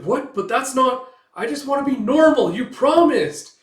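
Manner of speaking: high, loud betrayed wail; acted desperate, high-pitched pleading; distraught protest. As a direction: cry out as if betrayed.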